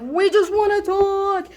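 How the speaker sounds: high, slow imitation of police; cops calling out. Cops to fleeing kids, calling out in drawn-out voice. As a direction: stretch it out like a call after runaways.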